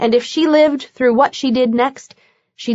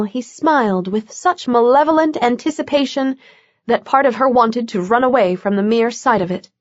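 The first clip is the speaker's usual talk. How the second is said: usual speaking voice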